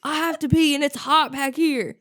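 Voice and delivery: high, loud whiny complaint with a laugh; drunk whining. Retold or imitated. Tracy snapping back from the hot trunk, loud whine.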